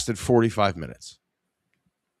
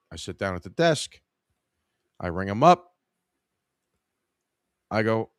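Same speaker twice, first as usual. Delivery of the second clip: ordinary talk, nothing marked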